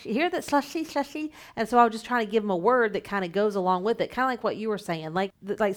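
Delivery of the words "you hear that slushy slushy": high, bright therapist voice; gentle feedback voice. Shown as a demonstration. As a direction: coax a child kindly. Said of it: "then I'll say".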